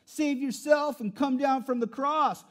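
loud, slow jeer: "save yourself and come down"; deriding passerby. Acted. Passerby taunting Jesus to come down, slow loud jeer. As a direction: sneer it up at the cross. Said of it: "those who passed by derided him".

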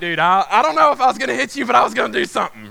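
fast, high, agitated blurting; retold rattled reply. Rattled victim answering his prankster buddy, fast acted agitation. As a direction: tumble through it with leftover adrenaline.

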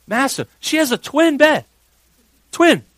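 high-pitched, shouted bafflement; loud disbelief. Retold or imitated. Dad discovering his daughter's huge gash, loud disbelief. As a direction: blurt it out high and loud, astounded.

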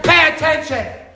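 slow stern teacher command. Retold or imitated. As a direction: bark it like a strict teacher. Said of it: "and she said".

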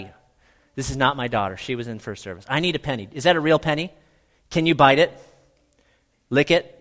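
ordinary talk, nothing marked

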